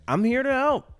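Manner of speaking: put-on high, casual child's voice; casual kid. Kid to delivery driver at the car, casual child's voice. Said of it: "It's just so casual".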